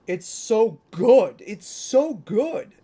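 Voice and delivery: slow, loud, high-pitched gushing; gushing excitement. Real, not acted. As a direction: drag it out in delight. Gushing over toy design.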